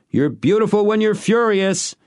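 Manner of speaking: high, loud shout across the yard. A narrator's reading. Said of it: "he shouted".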